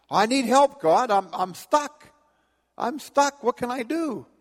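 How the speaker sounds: high, pleading and frantic; desperate pleading. Acted. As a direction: beg for help in a panic. Stuck man begging God.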